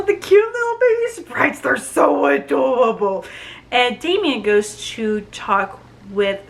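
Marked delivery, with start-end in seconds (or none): 0.0-3.2 high-pitched cooing over something cute; gushing. Real, not acted. Reviewer fawning over cute baby sprites, gushing. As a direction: coo it up high, smitten.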